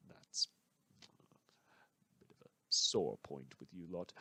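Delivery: snide whisper. Acted. Host needling the authorities, slow snide whisper. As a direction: slip the jab in under your breath.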